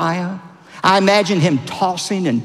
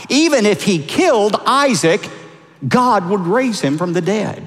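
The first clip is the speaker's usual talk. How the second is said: normal talking voice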